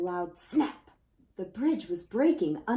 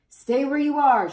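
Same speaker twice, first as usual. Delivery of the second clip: loud, higher call; shouting; 6 semitones higher, 11 dB louder than usual. A narrator's reading. Citizens shout to the children to stay put, loud. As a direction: shout across to keep them still. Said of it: "shouted the citizens".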